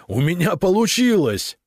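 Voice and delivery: very high, elated exclamation; disbelieving triumph. A narrator's reading. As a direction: cry out as if amazed at your own success. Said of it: "Не веря себе". (восторженно, не веря себе)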